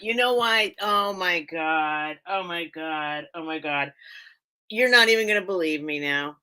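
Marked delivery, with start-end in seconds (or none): none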